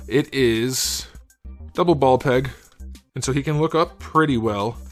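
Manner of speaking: ordinary talk, nothing marked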